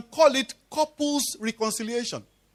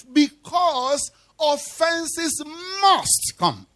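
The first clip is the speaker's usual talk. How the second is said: drawn-out, weighty scripture line; solemn slow quote of scripture; slow emphatic quote; 2 semitones higher, much slower than usual. Acted. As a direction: let each word land slowly. Quoting Jesus, drawn out.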